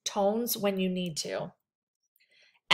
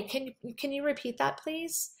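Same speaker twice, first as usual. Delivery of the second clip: softer and rising, politely asking; gentle polite request; 5 semitones higher, 8 dB quieter than usual. Shown as a demonstration. Counselor softly asks a client to repeat what they said. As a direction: ask gently so they don't feel judged. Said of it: "I say".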